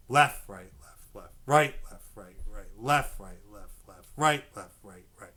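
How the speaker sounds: accents voiced, diddles dropped to a near whisper; sticking with whispered diddles; called sticking. Shown as a demonstration. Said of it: "counting the sticking pattern".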